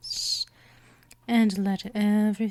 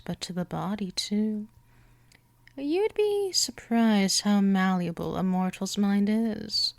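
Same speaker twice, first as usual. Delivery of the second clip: usual speaking voice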